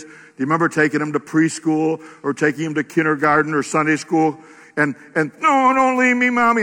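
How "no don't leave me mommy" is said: put-on high, pleading little kid voice; clingy child. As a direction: beg like a child at the school door.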